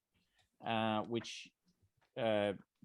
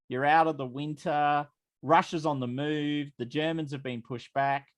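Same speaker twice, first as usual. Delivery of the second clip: game master painting the scene, a bit raised; scene setting; 4 semitones higher, 5 dB louder than usual. Acted. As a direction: set the scene for the players, lightly lifted. GM sets the morning scene.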